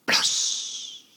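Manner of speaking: sharp splash noise; splash sound. Acted. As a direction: make a loud splash.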